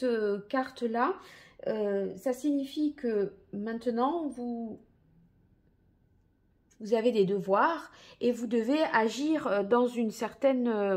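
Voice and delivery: ordinary delivery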